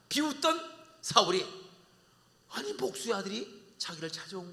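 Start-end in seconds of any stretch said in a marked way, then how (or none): none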